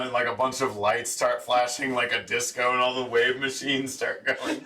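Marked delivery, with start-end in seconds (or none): none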